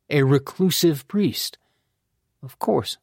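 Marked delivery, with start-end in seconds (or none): none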